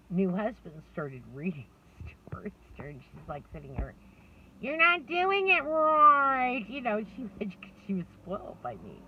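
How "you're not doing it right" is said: put-on high, loud, slow whine of a child; whiny child complaint. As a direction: drag it out like a fed-up little girl. Daughter complains about stepdad's reading.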